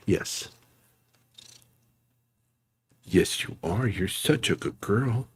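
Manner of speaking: hushed, slow, tender praise; soft pet praise. Real, not acted. Streamer quietly praising his kitten. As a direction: murmur praise to a cat on your lap.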